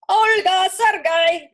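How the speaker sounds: high, loud call across the yard. A narrator's reading. Parents call their children from the horse and cart. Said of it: "they called".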